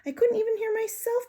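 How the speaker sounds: lifted high little hamster. Acted. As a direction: say it high over the classroom noise. Hamster narrating over noise.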